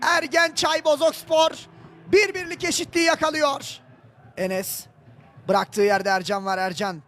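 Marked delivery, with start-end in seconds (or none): 0.0-3.8 raised, still-thrilled commentator voice; excited goal announcement. Real, not acted. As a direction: announce the scorer with joy. Scorer announced after goal.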